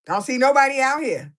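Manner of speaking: mock loud complaint. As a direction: call it out across an empty beach. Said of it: "She like".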